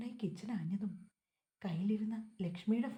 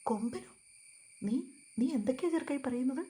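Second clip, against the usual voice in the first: fast, louder rush of alarm; trembling voice; 4 semitones higher, 5 dB louder than usual. Acted. Uncle startled by the news of the tusker, fast shaken alarm. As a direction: blurt it out shaken and quick. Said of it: "അയാളുടെ ശബ്ദം വിറച്ചു തുടങ്ങിയിരുന്നു".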